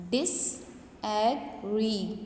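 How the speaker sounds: "disagree" very slowly in three separate syllables. Shown as a demonstration. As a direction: break "disagree" into dis, a, gree with pauses. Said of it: "it is demarcated into three syllables".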